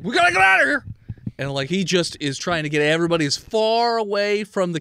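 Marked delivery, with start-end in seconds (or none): 0.0-0.8 very high, loud panicked shout; shouted warning. Acted. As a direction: yell them back from danger. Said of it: "he's like".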